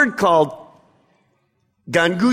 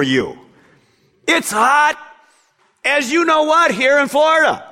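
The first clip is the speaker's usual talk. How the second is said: higher-pitched indignant worker's gripe; acted aggrieved roofer, pitched up; complaining roofer; 5 semitones higher than usual. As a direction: protest to the boss about unequal pay. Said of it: "Let's put it in a Florida context".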